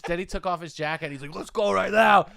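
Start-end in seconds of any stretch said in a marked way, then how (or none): 1.3-2.3 louder, higher call to fight; Knife Guy calling the comic out to fight; fight challenge. Retold or imitated. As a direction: square up and dare him.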